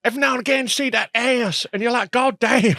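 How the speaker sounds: high, hammed-up southern American mimicry; mock him with an over-the-top southern American voice; exaggerated southern American accent. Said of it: "suddenly start talking like that".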